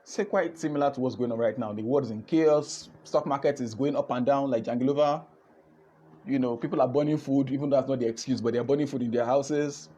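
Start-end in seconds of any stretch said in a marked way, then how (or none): none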